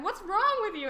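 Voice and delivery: loud, high mock exasperation; exasperated mom. Mom confronting the crying child, loud exasperation. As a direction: play mom, baffled and fed up. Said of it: "she was like".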